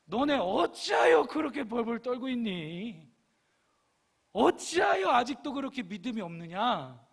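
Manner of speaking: loud, high mock-furious scolding; angry temper. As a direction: shout it as an exaggerated angry rebuke. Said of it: "막 성질을 부리면서 화를 내면서".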